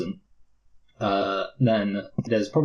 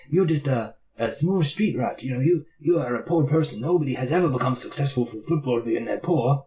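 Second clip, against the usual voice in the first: a higher, mocking take on a dismissive father; mocking the dad; 4 semitones higher than usual. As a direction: sneer at the boy's dreams the way the dad said them. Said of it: "the way he says it just seemed, like, really stupid".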